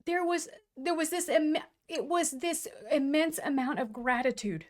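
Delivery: choked up, pushing the words through; crying with gratitude. Real, not acted. Telling her co-host about grateful tears, crying. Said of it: "You're crying now".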